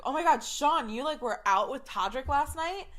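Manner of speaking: acting out amazed, excited castmates; excited exclamation. Castmates pounce on Sean at rehearsal, excited.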